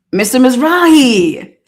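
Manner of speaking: loud, slow sing-song call. Acted. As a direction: call his name across the room, stretched. Calling out to lawyer on screen.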